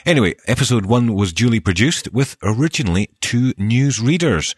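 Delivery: ordinary talk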